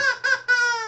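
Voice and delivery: very high squeaky puppet cackle; a bird puppet's shrill chuckle; high cartoon bird laugh; puppet squawk laugh.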